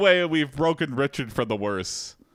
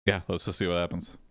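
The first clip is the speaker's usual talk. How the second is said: ordinary talk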